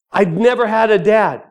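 high, loud objection to the Lord; earnest protest to God. Acted. Answers back to God in dialogue.

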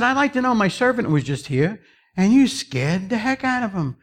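high-pitched confrontation; acted annoyed master; indignant master. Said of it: "kind of mad".

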